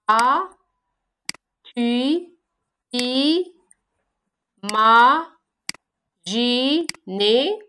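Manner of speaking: each syllable said apart with long pauses; slow syllable-counting read. Shown as a demonstration. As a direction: tap out every syllable separately. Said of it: "Chaque vers se forme des syllabes".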